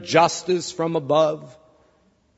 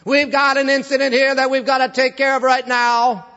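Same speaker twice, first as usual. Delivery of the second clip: judge's mock proclamation; 6 semitones higher, 3 dB louder than usual. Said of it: "the judge said to everybody".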